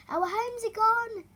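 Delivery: high, panicked cry; frightened. Acted. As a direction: cry out in alarm. Frightened field mouse.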